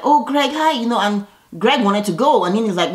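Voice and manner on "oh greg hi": high bright fake-friendly hello; mock Cynthia greeting. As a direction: sing out hi like you're thrilled to see him. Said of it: "And Cynthia is like".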